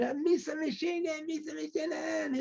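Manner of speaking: high, soft mock-laughing jeer; mocking laughter. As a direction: imitate a jeering leader laughing off a curse. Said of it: "our leader started laughing".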